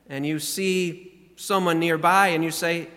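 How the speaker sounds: usual speaking voice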